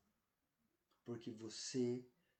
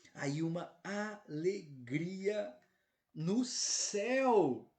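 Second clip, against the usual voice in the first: very slow, building to a loud, high "céu"; exultant proclamation; 5 semitones higher, 6 dB louder, much slower than usual. Real, not acted. Preacher repeating heaven's joy to the flock, exultant cry. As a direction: draw out every word and swell into a ringing cry.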